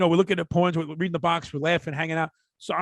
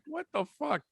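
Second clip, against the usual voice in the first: stunned aside; 8 semitones higher, 8 dB quieter than usual. Retold or imitated. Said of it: "I'm like".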